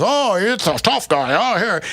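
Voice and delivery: a hint of an amused old man sizing up a kid; old Charlie teasing. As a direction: tease the young tough guy before the arm wrestle. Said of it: "And Charlie goes".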